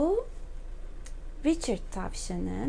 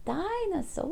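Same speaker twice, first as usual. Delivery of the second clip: mild storybook shout; 7 semitones higher, 4 dB quieter than usual. A narrator's reading. George calling out in the story, mock shout. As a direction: call it out high without much force. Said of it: "diye bağırıyor George".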